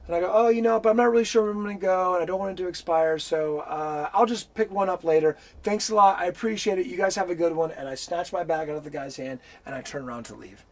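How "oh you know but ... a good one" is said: fake polite brush-off.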